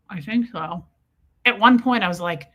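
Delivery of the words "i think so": mock-discreet reply from one corner of the mouth; covert, lopsided-mouth delivery; side-of-mouth talk. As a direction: answer out of the side of your mouth. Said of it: "switch back to the middle of our mouths".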